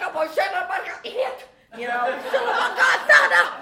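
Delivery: high, loud, comic ranting; frantic outburst. Retold or imitated. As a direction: re-enact a panicked tirade at full pitch. Re-enacts frantic outburst at the man.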